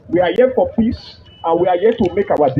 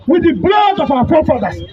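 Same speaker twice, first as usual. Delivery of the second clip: raised, high-pitched rallying cry to the crowd; protest rally speech; 8 semitones higher than usual. Real, not acted. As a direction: fire up the protesters.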